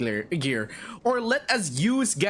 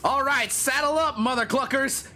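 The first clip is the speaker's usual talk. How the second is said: higher-pitched gung-ho rallying cry; rally the posse, pitched up high; rallying call; 7 semitones higher than usual. Acted. Player rallying before the bandit fight, gung-ho.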